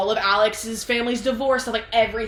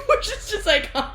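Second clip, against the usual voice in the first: high, squeaky talk through laughter; helpless laughing. Real, not acted. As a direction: barely get it out for laughing. Cracking up at the reveal.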